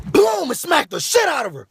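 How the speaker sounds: high, loud, drawn-out boom; shouted comic sound effect; explosive retelling. Retold or imitated. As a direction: punch out the impact slowly. Acting out the smack.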